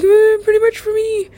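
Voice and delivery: very high, loud put-on whine; mock whine. Calling hell [name] for them, high mock whine. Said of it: "I'm like".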